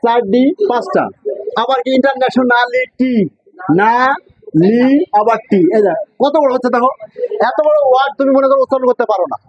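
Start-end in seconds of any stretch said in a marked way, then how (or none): 3.8-5.6 না, লি, টি as separate syllables with pauses; teacher spacing out না, লি, টি one by one. Shown as a demonstration. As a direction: sound out না, then লি, then টি. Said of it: "এইভাবে syllable ভাঙ্গি ভাঙ্গি করবা".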